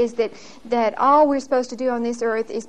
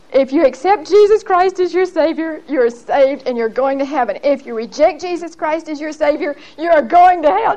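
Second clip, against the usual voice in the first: mock-blurting aunt at dinner, pitched up and louder; 4 semitones higher, 4 dB louder than usual. Aunt at dinner blurts a warning to her daughter, mock-blurted. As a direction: blurt it out of the clear blue sky like the aunt. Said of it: "she blurts out".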